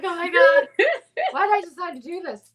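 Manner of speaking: high, loud panicky overwhelm, played for laughs; mock hyperventilating. Retelling blowing up all those balloons, mock hyperventilating. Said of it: "hyperventilating, blowing up all those balloons".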